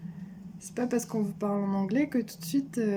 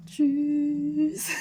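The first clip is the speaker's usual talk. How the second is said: high, drawn-out mock goodbye; lingering high-pitched farewell; playful sign-off; 6 semitones higher, 4 dB louder than usual. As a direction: stretch the jokey bye up high. Jokingly redoes the high goodbye.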